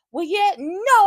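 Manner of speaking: loud, high fed-up reply; voicing Shiloh brushing off the producer, loud; mock outburst. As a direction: blurt it out, irritated.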